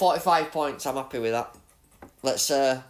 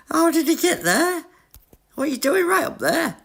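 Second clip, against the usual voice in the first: high, loud disbelieving laugh-yell; shrieking in disbelief at the rival; amazed; 13 semitones higher, 3 dB louder than usual. Real, not acted. Gamer yelling at rival car in shocked disbelief.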